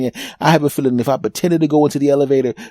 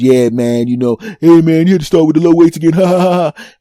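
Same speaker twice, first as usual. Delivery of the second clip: imitating a jeering gym buddy, louder; mock teasing laughter; 4 semitones higher, 6 dB louder than usual. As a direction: rib him and break into a hammed-up laugh. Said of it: "if my friends laughed at me".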